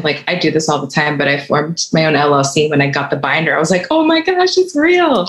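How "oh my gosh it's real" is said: thrilled squeal. Retold or imitated. Thrilled on getting the LLC binder.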